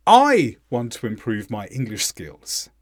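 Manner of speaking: highlight "I" and let every word after it drop; stress on I. Shown as a demonstration. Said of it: "Did you notice that I was stressed".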